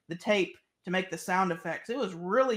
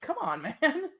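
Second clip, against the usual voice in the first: exasperated; 8 semitones higher than usual. Real, not acted. Mocking a pointless track.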